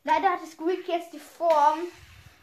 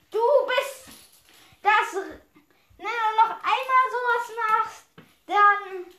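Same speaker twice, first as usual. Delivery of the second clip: high, raised-voice warning; angry threat; 6 semitones higher, 5 dB louder than usual. Acted. Hurt victim warning the helper, angry raised threat. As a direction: threaten loudly, higher-pitched.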